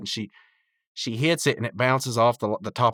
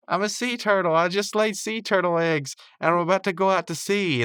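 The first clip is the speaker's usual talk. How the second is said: high-pitched playful character voice; Eileen impression; 9 semitones higher than usual. Eileen pretending to be a sea turtle, high and playful. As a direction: announce it in a high, play-acting voice.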